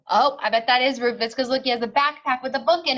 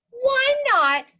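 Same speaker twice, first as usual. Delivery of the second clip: high, louder pleading why not; hurt surprise; 11 semitones higher, 6 dB louder than usual. Acted. Rufus asking the principal why pigs are banned, high and hurt. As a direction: protest it high.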